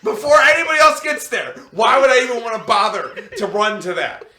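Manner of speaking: loud, laughing mock outrage; laughing outburst. Real, not acted. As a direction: yell it while cracking up.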